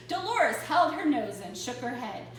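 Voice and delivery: ordinary delivery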